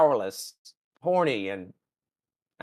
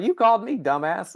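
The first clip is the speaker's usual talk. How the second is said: higher, sassy and dismissive; snarky put-down; 7 semitones higher than usual. Retold or imitated. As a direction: talk down to him. Alien rep scolds Pike.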